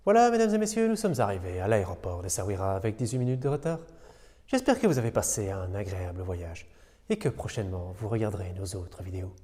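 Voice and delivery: soft, low airline captain announcement; pilot cockpit headset. Pretend. Pilot landing announcement role-play.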